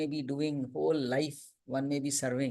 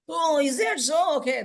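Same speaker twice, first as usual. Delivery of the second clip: mock surprised; 13 semitones higher, 8 dB louder, slower than usual. Worshipper's surprised reply, retold.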